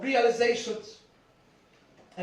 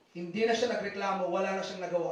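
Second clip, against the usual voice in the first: ordinary delivery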